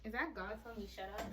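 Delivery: ordinary delivery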